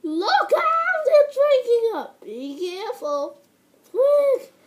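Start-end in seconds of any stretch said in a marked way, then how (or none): 0.0-2.2 high, loud alarm; warning shout. Acted. Warning about monster. 3.9-4.5 high loud call; urgent shout. Acted. Urging hurry in danger.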